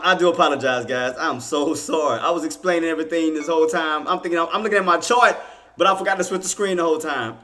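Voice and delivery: ordinary talk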